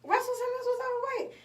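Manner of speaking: high, fast and incredulous; amazed disbelief. Real, not acted. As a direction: rattle it off in shock. Disbelief at Russell being overweight.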